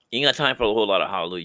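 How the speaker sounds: usual speaking voice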